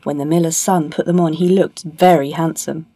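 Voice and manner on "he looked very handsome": ordinary delivery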